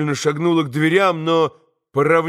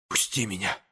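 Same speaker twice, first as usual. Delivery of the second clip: soft, hushed, barely voiced; whispered plea; 6 semitones lower, 10 dB quieter than usual. A narrator's reading. Woman quietly begs Mitya to let her go, whispering. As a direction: breathe it out quietly to him. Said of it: "прошептала она".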